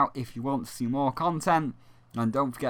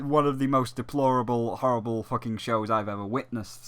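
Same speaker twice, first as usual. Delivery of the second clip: usual speaking voice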